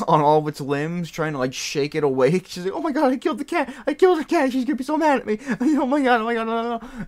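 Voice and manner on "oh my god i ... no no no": very high, loud panicked coworker impression; hammed-up frantic wail, as if it's a disaster; freaking out. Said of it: "they start freaking out".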